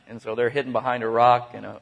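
ordinary talk, nothing marked

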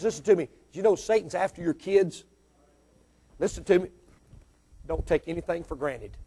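ordinary delivery

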